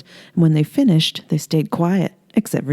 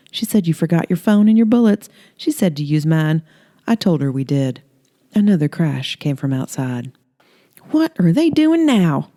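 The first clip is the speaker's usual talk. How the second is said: flirty Holly. Acted.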